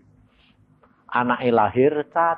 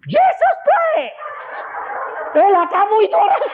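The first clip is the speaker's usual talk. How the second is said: very high, excited yell; shouting; 3 dB louder than usual. Retold or imitated. Man in the congregation yelling his testimony, shouting. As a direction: cry out across the hall. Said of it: "Dia teriak".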